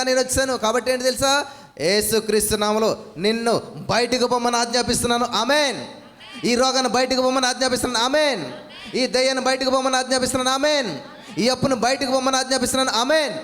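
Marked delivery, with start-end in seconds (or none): 1.8-13.3 a preacher's shouted rebuke of demons and debt; commanding rebuke. Shown as a demonstration. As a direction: order the sickness out with full authority.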